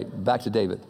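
ordinary talk

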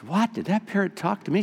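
can't believe the bird spoke; surprised lady. Acted. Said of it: "She says".

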